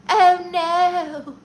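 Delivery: high, loud cartoon "oh no"; hammed-up high-pitched cartoon character voice. Streamer imitating a funny cartoon character, high mock dismay.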